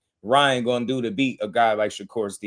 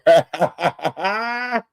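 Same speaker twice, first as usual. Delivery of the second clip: high burst of laughter; 7 semitones higher than usual. Real, not acted. Laughs at the post.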